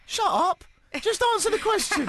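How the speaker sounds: very high mock-exasperated inner voice; annoyed at himself. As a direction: scold yourself in comic high-pitched panic. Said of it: "I started annoying myself".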